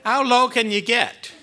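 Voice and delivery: mock outrage. Mock outrage at stolen verse.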